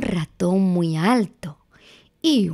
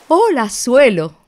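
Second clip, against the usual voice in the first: loud, higher mouse greeting; ratón muy bajo; 4 semitones higher, 6 dB louder than usual. Acted. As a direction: call hello loudly as a story mouse. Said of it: "Y ratón muy bajo". (saludo fuerte y agudo de ratón)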